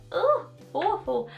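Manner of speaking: high, drawn-out disgusted ugh; mock disgust. Admitting she neglected a product, mock disgust. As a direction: groan it high with exaggerated distaste.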